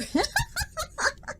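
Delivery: soft squeaky high giggle; a quiet little giggle. Real, not acted. Laughs at own joke.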